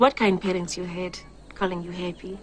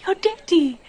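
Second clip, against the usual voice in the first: high, loud gasping disbelief, the last line slow; shocked the dead man came back; astonished; 10 semitones higher, 6 dB louder, slower than usual. Acted. Detective hears the dead father returned, astonished.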